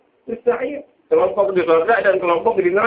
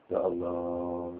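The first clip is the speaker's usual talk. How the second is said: a slow, much softer, lower "Ya Allah"; heavy sigh; 10 semitones lower, 11 dB quieter, much slower than usual. Acted. Sauban overwhelmed by the thought of separation, sighing heavily. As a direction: drag it out like a weary groan. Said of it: "dia pusing, dia berat".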